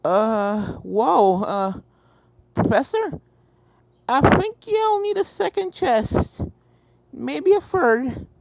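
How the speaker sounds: put-on giddy, dazzled young filly, slow and high; Apple Bloom telling the Professor she found a fortune; excited filly. Apple Bloom finds treasure.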